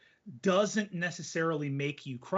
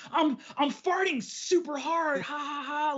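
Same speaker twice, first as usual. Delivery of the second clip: forced joke; 9 semitones higher than usual. Retold or imitated. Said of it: "the attempt to be funny".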